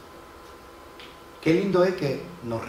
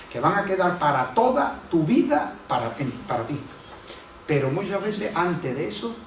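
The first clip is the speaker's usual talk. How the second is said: louder, rising fervent preaching that pushes hard; preaching at full force; 3 semitones higher, 4 dB louder than usual. Acted. Pastor rallying his congregation through crisis, fervent full-force preaching.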